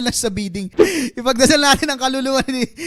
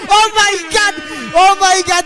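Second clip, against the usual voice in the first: excited; 8 semitones higher, 5 dB louder than usual. Pretend. Huge bid reaction.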